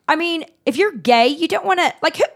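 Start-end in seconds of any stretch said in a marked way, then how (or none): none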